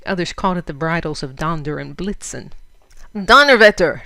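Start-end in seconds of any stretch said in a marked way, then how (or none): 3.1-4.1 booming German oath, loud; a tremendous shout of "Donnerwetter!". A narrator's reading. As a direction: bellow it with all his voice. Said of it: "shouted Fritz, with all his tremendous voice".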